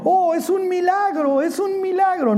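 preacher mocking Aaron's miracle excuse. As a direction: play the innocent who swears it appeared by itself. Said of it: "Y Aarón diciendo".

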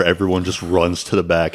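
ordinary talk, nothing marked

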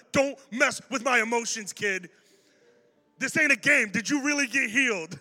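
loud, high, emphatic challenge; mock stern demand. Pastor warns a kid not to toy with him, loud and intense. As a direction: confront the kid in comic disbelief.

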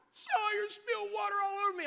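put-on high complaining little girl's voice; whiny daughter. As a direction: tattle like a wronged little girl. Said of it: "scream like only Jillian could".

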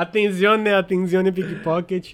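slow, laughing mock Italian warning; Italian accent. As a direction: call it out like the Venice pickpocket lady. Quoting the Italian pickpocket meme.

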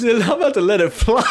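hammed-up gunman losing it to giggles; laughing mock threat. Imagining the stranger about to shoot, laughing mock threat. Acting out armed stranger, cracking up.

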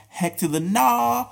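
high, slow and drawn out; sassy refusal. Real, not acted. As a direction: drag out the no with attitude. Emphatic refusal.

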